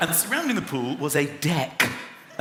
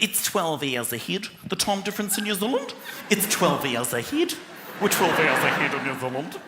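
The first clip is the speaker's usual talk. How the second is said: imitating a New Zealand stewardess, vowels shifted, questioning rises; New Zealand accent. Stewardess telling a passenger the time difference, New Zealand accent. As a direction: put on a New Zealand accent, lifting line ends. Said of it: "they talk like that in New Zealand".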